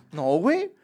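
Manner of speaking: ordinary delivery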